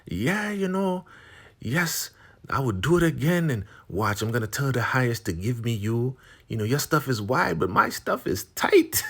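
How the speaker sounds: high, teasing boast; imitating a shameless taunting rival; gloating. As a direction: brag in a high mocking voice, building to a squeal of glee. Gloating rival in acted quarrel.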